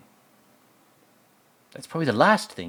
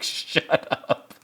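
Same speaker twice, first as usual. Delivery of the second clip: breathy put-on voice breaking into a laugh; laughing shut up; 7 semitones higher than usual. Rust silencing Marty, put-on voice through laughter. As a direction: snap it while cracking up. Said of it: "He's like".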